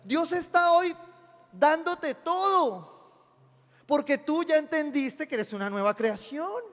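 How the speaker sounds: high, loud preacher's call; preaching at full force. Acted. Pastor preaching to his congregation, loud and high.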